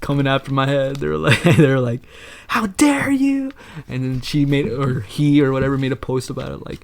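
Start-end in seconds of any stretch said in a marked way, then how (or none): 2.5-3.5 high, drawn-out imitation of outrage; mock outrage. Mimicking the hate mob coming after him, mock outrage. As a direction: stretch it out high like an offended mob.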